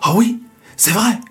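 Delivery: high, fast and excited; eager excitement. Acted. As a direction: burst out with childlike eagerness. Man eagerly wants to be tiger.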